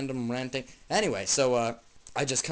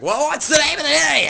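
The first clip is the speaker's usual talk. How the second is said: shrill-pitched loud question, much higher; loud high demand; 11 semitones higher, 10 dB louder than usual. Acted. Other guy asks, acted quote.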